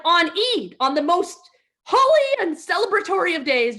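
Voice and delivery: loud, high mock exasperation; exasperated. Wife scolding husband on a holy day, loud and high. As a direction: build up the outrage.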